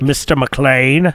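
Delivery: slow, drawn-out villain impression; Alan Rickman. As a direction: savor the name like a film villain. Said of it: "remember his stupid voice".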